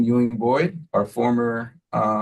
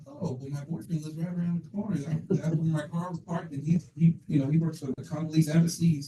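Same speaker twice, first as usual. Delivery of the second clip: a hint of the caller's voice, higher and much softer; lightly voiced caller; 5 semitones higher, 5 dB quieter than usual. Principal retelling the caller's words, higher and much softer. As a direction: quote her lightly, softer and up. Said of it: "She's like".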